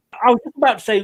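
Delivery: ordinary talk, nothing marked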